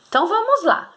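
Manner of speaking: ordinary talk, nothing marked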